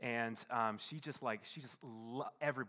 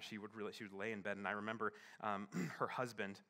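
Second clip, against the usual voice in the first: normal talking voice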